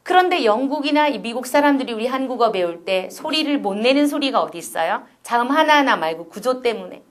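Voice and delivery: normal talking voice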